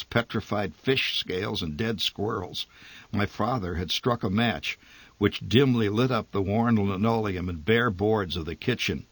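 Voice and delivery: plain reading voice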